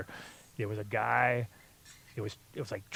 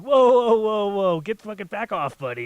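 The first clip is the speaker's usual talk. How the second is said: much higher and louder, waving him off; warning yell; 10 semitones higher, 11 dB louder than usual. Retold or imitated. Coworker warning off the stranger who stopped, loud yell. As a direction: bark him back from the load. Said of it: "He's like".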